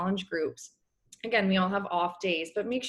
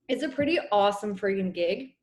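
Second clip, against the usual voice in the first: ordinary delivery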